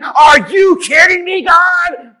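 loud, high cry of disbelief; exasperated, acted-out yell; outraged protest. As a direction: shout it up at God, incredulous. Imagined Paul's outraged protest to God.

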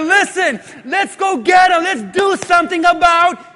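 high, loud shout; acted disciple rousing everyone to action; urgent rallying. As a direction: shout it insistently, voice lifted. Disciple rallies the others.

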